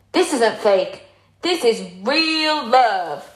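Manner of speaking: hammed-up, drawn-out teenage declaration; mock teen fangirl. Mocking own thirteen-year-old self watching Catching Fire, hammed-up fangirl. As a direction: play your obsessed younger self, stretching the words. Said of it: "I remember when I was, like, thirteen".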